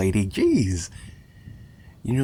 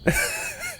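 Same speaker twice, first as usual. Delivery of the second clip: a quick, much higher giggle; 4 dB quieter than usual. Acted. Captor giggles to his captive about graves, high soft giggle. Villain laughing at his victim.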